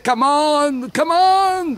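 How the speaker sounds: high rising yell; urging yell. Acted. Jester as horseman urging comrades, rising yell. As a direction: spur the troops on, building to a shriek.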